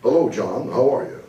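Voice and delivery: party small talk in broadcaster tones; stuck announcer voice. Acted. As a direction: greet a friend like a TV presenter. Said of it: "you get into this voice".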